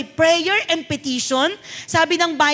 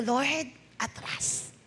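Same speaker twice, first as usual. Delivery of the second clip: soft, slowed-down prayer to the Lord; quiet slow plea; 4 semitones lower, 12 dB quieter, much slower than usual. Retold or imitated. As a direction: breathe it out slowly, backing away. Prays backing off at tuition.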